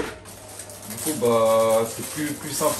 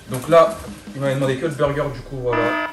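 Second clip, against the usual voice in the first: loud; 7 dB louder than usual. Acted. Calling out order loudly.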